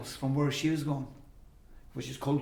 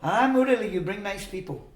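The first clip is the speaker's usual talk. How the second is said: imitated Swami greeting; 7 semitones higher, 5 dB louder than usual. Swami welcomes her guest, imitated delighted greeting. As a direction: greet as the Swami, pitched up, starting loud.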